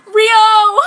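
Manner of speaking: loud, high cry of his name; hammed-up tearful shout. Sakura finding Ryo bleeding, a hammed-up tearful shout. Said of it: "I said, crying".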